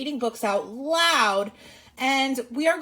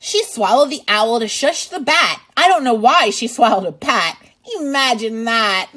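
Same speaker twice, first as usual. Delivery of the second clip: lively, louder read-aloud voice; reading to children; 3 semitones higher, 7 dB louder than usual. Acted. Teacher reading a picture book to kids, lively and loud. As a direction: read it out big for little listeners.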